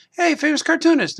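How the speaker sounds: high, eager fan calling out to him; excited fan greeting. Retold or imitated. Fan at a convention calling out to the cartoonist, high and eager. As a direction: call out to the famous guy like a fan.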